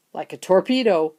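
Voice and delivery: ordinary delivery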